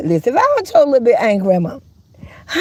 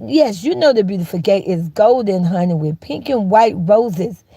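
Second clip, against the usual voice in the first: normal talking voice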